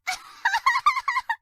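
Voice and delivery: high squealing giggle while being tickled. Acted. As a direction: burst out giggling, helpless and ticklish.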